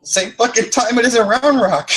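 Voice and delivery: high, mocking snap back; high sarcastic reply to a dumb question; sarcastic retort. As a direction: throw the obvious answer back at him. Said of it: "He asked me".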